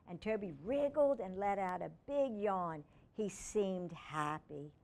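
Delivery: ordinary delivery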